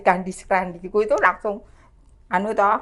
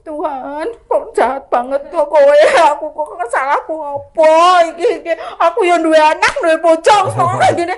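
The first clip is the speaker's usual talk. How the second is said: high wailing complaint, building louder; mock-weep a plea to God; re-enacted tearful lament; 5 semitones higher, 3 dB louder than usual. Woman hiding at the dishes to God, tearful lament. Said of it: "ngelus-ngelus piring sambil nangis".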